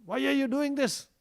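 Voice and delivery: high-pitched mock scolding; angry mother. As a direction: call out a child as a cross mother would. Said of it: "Mother Yashoda will angrily call Krishna".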